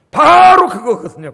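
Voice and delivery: loud, high, slow proclamation; emphatic shout. Real, not acted. Preacher drives home his point, shouting. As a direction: shout it out to the congregation.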